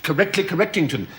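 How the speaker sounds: ordinary talk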